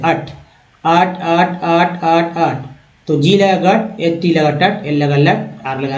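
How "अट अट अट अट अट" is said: अट repeated crisply, short vowel and hard ट; drilled sound. Shown as a demonstration. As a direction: drill अट for the class. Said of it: "ओटी को अट बोलते हैं". (अट को छोटे स्वर और कड़े ट के साथ दोहराएँ)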